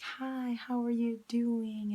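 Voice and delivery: high, drawn-out cheery greeting; imitated friendliness. Market worker greeting her, imitated friendliness. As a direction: mimic his over-friendly hello, pitched well up. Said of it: "he was very friendly".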